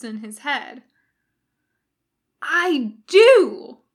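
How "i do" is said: high, very loud exclamation; triumphant shout. A narrator's reading. Jim hitting on an idea with Bones, shouting. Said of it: "he shouts".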